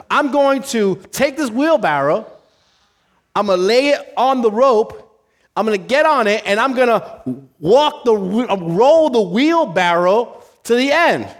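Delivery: loud, high, deliberate stunt build-up; showman announcing. Acted. Tightrope walker explains wheelbarrow stunt, grand showman. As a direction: sell each step of the feat to the crowd.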